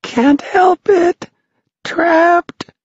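very high tiny wail; high squeaking lament; small wee shout. A narrator's reading. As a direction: cry out slowly, trapped. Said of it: "shouted the small, wee voice".

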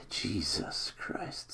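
slow breathy whisper; relieved whisper. Real, not acted. Fan relieved by a one-point win, a slow whisper. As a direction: exhale it with relief.

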